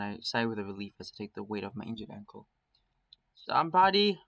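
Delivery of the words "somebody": loud high cry for help; panicked call. Acted. Raven panicking and calling for somebody. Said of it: "Raven's panic voice".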